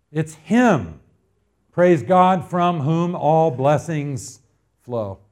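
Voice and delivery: slow, swelling pulpit recital; declaimed scripture reading. Acted. Pastor reading verse to congregation, proclaiming. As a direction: proclaim each title of God slowly.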